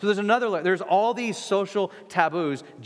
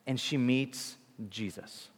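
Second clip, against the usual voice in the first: hushed reveal; 4 semitones lower, 7 dB quieter than usual. Acted. Dramatic pause, story climax.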